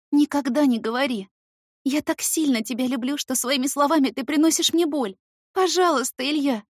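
high loud plea on the edge of sobbing. Acted. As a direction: beg him through sobs. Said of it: "Громко всхлипываю".

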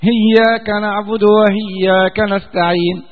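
"إياك" said hiyyāka, with an h for the hamza; ha instead of hamza. Shown as a demonstration. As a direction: swap the hamza of "إياك" for a ha, slowly. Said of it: "قراءة من القراءات". ("إياك" بالهاء بدل الهمزة، هياك)

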